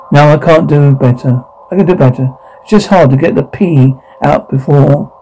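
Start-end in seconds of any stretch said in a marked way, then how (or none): none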